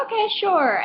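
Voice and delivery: high-pitched mock-willing reply; acted over-polite okay; uneasy agreement. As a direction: imitate an obliging cashier, pitched high and falling. Cashier agreeing to strange request.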